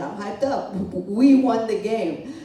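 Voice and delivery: loud and drawn out, pumped; hyped up. Real, not acted. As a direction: shout it like a fan after a win. Hyped after Cowboys win.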